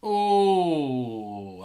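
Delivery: long, loud "oh" of a stretch; stretching groan. Real, not acted. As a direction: let out a drawn-out groan as you stretch. Stretching after sitting all night.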